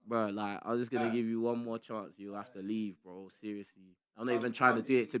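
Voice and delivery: low, soft final warning; quiet warning. Real, not acted. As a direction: drop the voice to warn.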